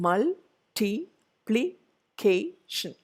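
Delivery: "multiplication" very slow as mul, ti, pli, ca, tion; a clear gap after each syllable of "multiplication". Shown as a demonstration. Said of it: "five syllable".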